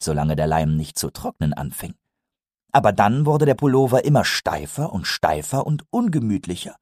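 ordinary delivery, nothing marked

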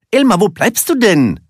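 high, loud impatient call; excited storybook shout, pitched well up. A narrator's reading. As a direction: call across to a dawdling cousin. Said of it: "rief Willi".

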